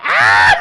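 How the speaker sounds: very high, loud excited shriek; sudden high yelp. Real, not acted. Reacting to fight on screen.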